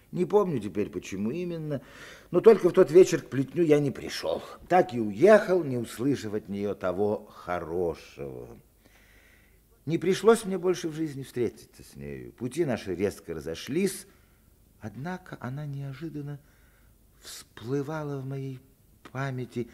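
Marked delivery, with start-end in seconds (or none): none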